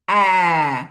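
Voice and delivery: অ্যা said low and loud as an isolated sound. Shown as a demonstration. Teacher sounds out অ্যা for a child, low and loud. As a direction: stretch অ্যা as a single vowel sound. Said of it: "ওকে একটু সাউন্ডটা শোনাবেন".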